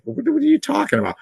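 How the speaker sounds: the prophet balks at God's order; incredulous protest. Retold or imitated. Ananias protesting to the Lord, high and fast in disbelief. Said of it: "Ananias argues".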